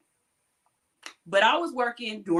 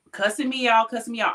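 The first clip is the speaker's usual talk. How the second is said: ordinary delivery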